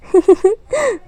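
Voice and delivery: a short high giggle; coy giggle. Acted. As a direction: laugh shyly at his answer.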